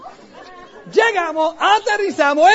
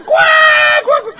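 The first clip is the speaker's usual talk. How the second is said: high loud cackling laugh; imitated cackle; 9 dB louder than usual. Imitating John cracking up in the restaurant, high loud cackle. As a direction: mimic a friend's wild laughter.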